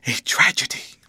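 forceful, breathy stage whisper; solemn whisper. A narrator's reading. Guardian confiding the clerk wrote a tragedy, solemn whisper. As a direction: whisper it like a grave secret. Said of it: "in a solemn whisper".

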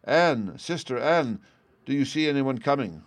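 high, urgent call to her sister; crying out. A narrator's reading. Poor lady calls to her sister in the tower, crying out. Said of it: "cried out to her".

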